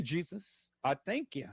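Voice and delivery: ordinary delivery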